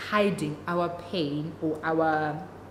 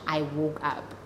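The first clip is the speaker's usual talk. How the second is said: ordinary talk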